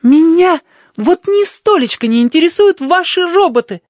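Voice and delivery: high, loud furious exclamation; angry exclamation. A narrator's reading. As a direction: hurl it at him. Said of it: "воскликнула она".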